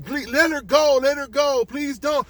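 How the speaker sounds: high, loud urgent pleading; bystanders crying out. Acted. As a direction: shout pleas from the crowd. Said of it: "they're saying the same".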